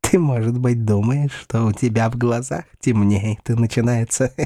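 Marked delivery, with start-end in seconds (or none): none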